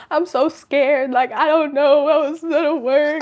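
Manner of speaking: mock-tearful, sing it out, a little stretched; retold scared plea. Retelling crying before the test, mock scared singsong. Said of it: "I'm like".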